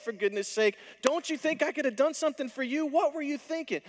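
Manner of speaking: high, loud, exasperated scolding; scolding disappointment. Pretend. Preacher voicing a scolding Jesus who never spoke so, exasperated. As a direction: scold her like a let-down parent. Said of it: "Jesus doesn't have that tone at all".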